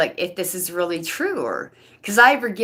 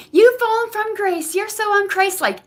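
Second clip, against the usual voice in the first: much higher, louder, sanctimonious; mock preachy message; 4 dB louder than usual. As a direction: sneer it like a pious accuser. Reading the copycat's message mockingly.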